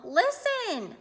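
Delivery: high, loud command; excited yell. A narrator's reading. Sister making Arthur hear the TV, excited yell. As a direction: call out urgently to your brother. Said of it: "screamed D W when Arthur got home".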